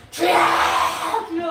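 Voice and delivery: loud desperate cry; crying out to the Lord. Acted. Sick man cries out to the Lord again, loud and desperate.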